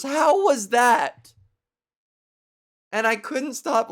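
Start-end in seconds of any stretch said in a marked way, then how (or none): 0.0-1.3 much higher, slow, sly and louder; a prankster coyly asking after his fart. Retold or imitated. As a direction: ask it teasingly, drawn out. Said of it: "He goes".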